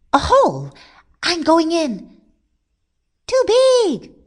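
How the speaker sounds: high, loud squeaky rabbit; excited rabbit. A narrator's reading. Rabbit fleeing finds a hole, high excited squeal. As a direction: squeal it urgently.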